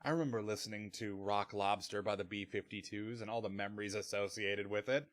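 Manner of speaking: soft, low, mock-wistful musing; hushed, dreamy reminiscence, acted out; wistful reminiscing. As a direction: murmur it as if lost in fond memories. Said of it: "wistfully being like".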